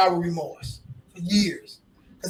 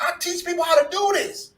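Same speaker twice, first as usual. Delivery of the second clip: pleading outcry; 13 semitones higher than usual. Retold or imitated. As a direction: cry out "why you ain't with me" in disbelief. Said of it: "I feel emotional right now about it".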